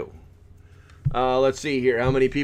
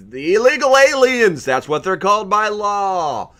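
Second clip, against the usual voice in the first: mock alarm; 7 semitones higher, 5 dB louder than usual. Mock alarm about border.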